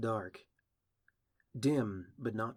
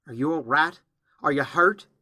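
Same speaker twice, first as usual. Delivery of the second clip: loud, raised, anxious questions; urgent concern; 5 semitones higher, 13 dB louder than usual. Acted. Applejack checking the fallen filly, urgent concern.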